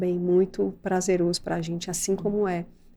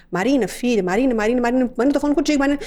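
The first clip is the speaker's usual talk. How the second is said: louder, raised call to the daughter; insistent calling; 5 semitones higher, 5 dB louder, much faster than usual. Retold or imitated. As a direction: call her name again and again, getting higher.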